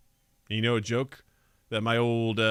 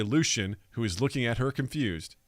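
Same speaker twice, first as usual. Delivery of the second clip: ordinary talk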